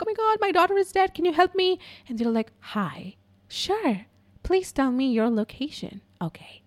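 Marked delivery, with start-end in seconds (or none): 0.0-1.8 high, rushed mock panic; distraught mom. A mom begging the dispatcher for help, acted panic. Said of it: "it's so painful to listen". 2.6-6.6 soft, unhurried imitation of a call handler; mock the soothing emergency-line voice; overly calm dispatcher. Dispatcher answering a desperate mom, mock calm and soft. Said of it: "they speak like that".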